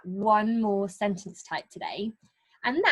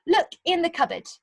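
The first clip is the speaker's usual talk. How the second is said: bossy command; 7 semitones higher than usual. Shown as a demonstration. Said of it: "very bossy little character".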